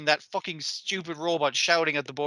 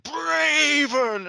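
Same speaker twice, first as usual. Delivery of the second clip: high, shouted name call; robot shouting its name; 11 semitones higher than usual. Retold or imitated. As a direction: bellow the name like a proud giant robot. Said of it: "he's like".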